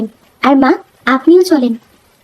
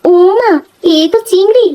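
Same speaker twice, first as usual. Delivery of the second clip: she can't believe her eyes; a joyful exclaim at the sight of prawns; delighted surprise; 8 semitones higher than usual. Acted. Wife sees many prawns.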